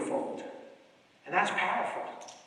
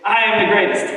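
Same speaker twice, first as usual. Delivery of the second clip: loud affirmation; 12 dB louder than usual. Shown as a demonstration. Speaker leading the crowd in a chant, loud. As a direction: belt "I am the greatest" as a rallying cry. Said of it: "I wanna hear you say".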